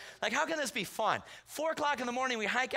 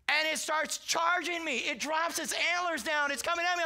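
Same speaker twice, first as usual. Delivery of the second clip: high, urgent storytelling; alarmed excitement; 8 semitones higher than usual. Retold or imitated. Hunter tells of the deer charging, alarmed excitement. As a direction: pitch it up as danger closes in.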